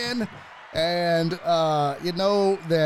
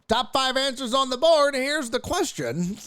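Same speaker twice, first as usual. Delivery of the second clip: higher-pitched game show host announcing; old-school game show host; 4 semitones higher than usual. Retold or imitated. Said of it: "Remember how they would be like".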